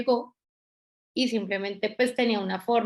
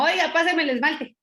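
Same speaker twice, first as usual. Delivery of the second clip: higher and louder, like giving orders; bossy, brusque; 6 semitones higher, 4 dB louder than usual. Retold or imitated. Blunt new girl demanding nail polish from coworker, curt and bossy. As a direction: bark it out as a command. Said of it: "Pero brava, como dando órdenes".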